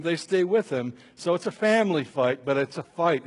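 ordinary talk, nothing marked

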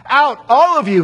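loud, high command to clear the room; a king shouting at his servants; cried out. A narrator's reading. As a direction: order everyone out, loud and high. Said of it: "he cried".

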